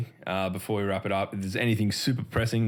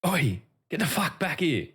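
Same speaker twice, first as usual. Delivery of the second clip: mock street yell, pitched up at usual volume; retold yell; 8 semitones higher than usual. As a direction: imitate a bloke calling out sharply without full volume. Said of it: "I heard this bloke yell out".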